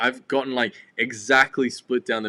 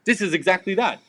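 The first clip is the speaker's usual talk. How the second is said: higher, emphatic mock pitch; excited pitch; 8 semitones higher, 5 dB louder than usual. Co-host voicing the reboot's perfect fit, excited. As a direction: act out an excited sales pitch.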